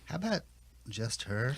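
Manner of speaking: very soft, low murmur; meek murmur. Acted. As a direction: murmur it hopefully under your breath. Victim murmurs.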